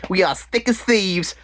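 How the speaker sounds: high, drawn-out mock declaration; put-on reality-show housewife voice; housewife impression. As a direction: draw it out, imitating the housewife. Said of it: "the one girl saying".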